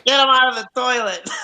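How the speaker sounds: shrill high yell to clear the toilet. Retold or imitated. Acting out angry yelling staff.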